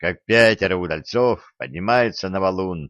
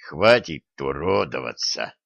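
low, strained rasp; hoarse croak; 6 semitones lower than usual. A narrator's reading. Injured man stops the mourning girl, croaking. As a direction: force it out with effort. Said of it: "прохрипел Олег".

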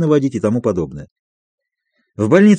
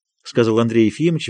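plain reading voice

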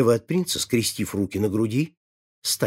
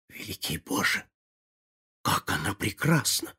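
hushed, lower, inward admiration; admiring private thought; 3 semitones lower, 6 dB quieter than usual. A narrator's reading. Prince silently admires the duchess, hushed and inward. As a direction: think it softly to yourself, struck by her. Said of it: "подумал принц".

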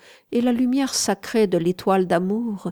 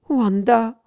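a high, loud cry of the name; 4 semitones higher, 6 dB louder than usual. A narrator's reading. Anguished lover crying out to the mistress, loud. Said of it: "criai-je".

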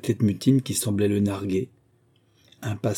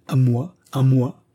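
higher, louder outraged question, said twice; indignant exclamation; 3 semitones higher, 6 dB louder, slower than usual. A narrator's reading. Elder sister refusing the errand, in affronted disbelief. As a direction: repeat it as if affronted. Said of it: "s'écria-t-elle".

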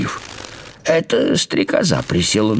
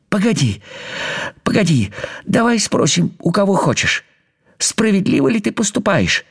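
high, imploring little voice; pleading ant; 11 semitones higher than usual. Acted. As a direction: beg him to wait. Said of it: "взмолился муравей". (высоко, умоляюще)

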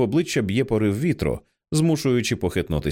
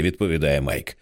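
ordinary delivery, nothing marked

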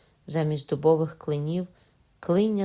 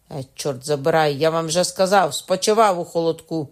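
loud, irritated repeat; exasperated outburst; 7 dB louder than usual. A narrator's reading. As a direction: snap it back, fed up. Prisoner annoyed repeating answer.

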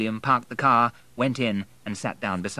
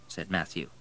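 ordinary delivery, nothing marked

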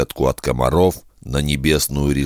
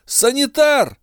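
calling out. Acted. Calling for medic again.

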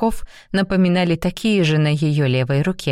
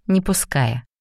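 ordinary delivery, nothing marked

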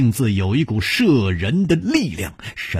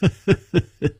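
high, rapid chuckle; amused laugh; 7 semitones higher than usual. Acted. Nangong laughs along with Chu, high amused chuckle. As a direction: chuckle in a quick high run. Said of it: "南宫绫笑着说".

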